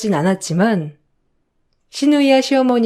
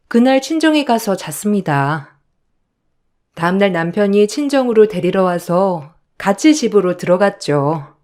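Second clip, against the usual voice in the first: ordinary delivery, nothing marked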